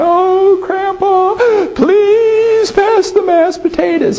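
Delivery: put-on very high, wailing little girl voice; mock sobbing child begging for potatoes; granddaughter crying. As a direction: whine it like a weepy granddaughter. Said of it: "his granddaughter starts crying".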